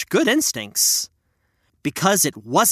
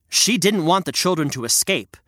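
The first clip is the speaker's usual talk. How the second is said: plain reading voice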